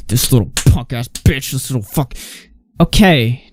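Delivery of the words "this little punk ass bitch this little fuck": mock angry man. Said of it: "a grown-ass man who's like".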